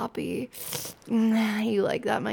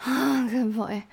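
louder voiced praise, full tone; voiced aloud; 2 semitones higher, 4 dB louder than usual. Praises her partner after climax, voiced louder. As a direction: say it out loud with full voice.

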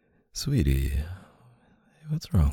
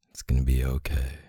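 ordinary delivery

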